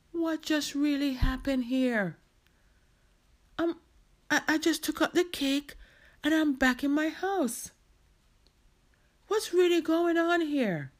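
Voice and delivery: bewildered. Acted. Marcia back in her house with cakes, bewildered. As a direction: puzzle it out with mounting disbelief.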